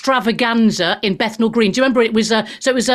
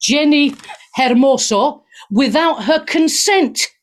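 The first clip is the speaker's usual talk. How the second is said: ordinary delivery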